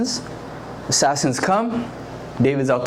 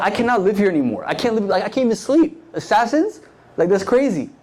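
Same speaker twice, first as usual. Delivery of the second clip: fast, higher, rattled; frantic David; 7 semitones higher than usual. Acted. David telling of the assassins, fast and rattled. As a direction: spill it out like he hasn't slept. David can't sleep, hunted.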